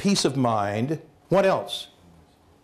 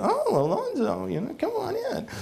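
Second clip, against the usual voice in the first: put-on very high, soft, girlish coo; mock swooning falsetto wife; smitten wife; 3 dB quieter than usual. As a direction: gush sweetly over the hunky plumber. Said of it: "he is a hunk ... And she goes".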